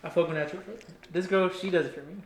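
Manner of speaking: tipsy, soft and loose. Real, not acted. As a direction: slur softly like tipsy. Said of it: "He being drunk".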